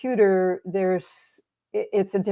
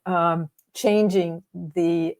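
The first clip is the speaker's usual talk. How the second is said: ordinary talk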